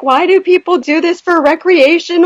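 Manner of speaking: high tearful whine; retold crying. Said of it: "I was crying because I'm like".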